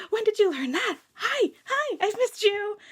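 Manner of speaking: high, excited squeal of affection; gushing reunion. Acted. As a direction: greet a dearly missed friend, overjoyed. Reunion with long-lost friend.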